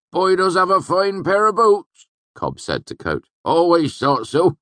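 high, loud rustic question; curious old villager. A narrator's reading. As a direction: squint and ask, curious and loud. Cobb chats to innkeeper.

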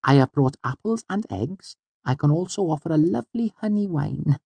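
high, earnest little voice; Auri. A narrator's reading. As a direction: make a formal offer, daintily and pitched high. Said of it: "she said, her face serious".

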